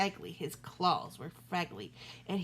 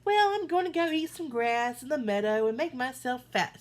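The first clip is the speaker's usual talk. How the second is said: louder, higher goat voice; cheeky little goat; 4 semitones higher, 6 dB louder than usual. A narrator's reading. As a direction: chirp it boldly. Little goat tells troll.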